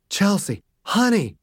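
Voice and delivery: a father in pain begging his daughter, breathless; breathless pleading. A narrator's reading. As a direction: gasp the words out, hurting. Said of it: "hard to understand his words because he was breathing so hard".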